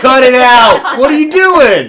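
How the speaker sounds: high, loud, laughing outrage; mock outraged shout. Podcast guests yelling at the hosts, mock outraged shout. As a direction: yell at the hosts in mock horror.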